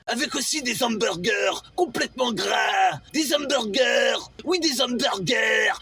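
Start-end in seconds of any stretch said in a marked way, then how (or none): none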